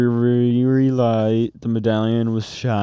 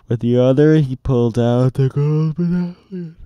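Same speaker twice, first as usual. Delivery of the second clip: plain reading voice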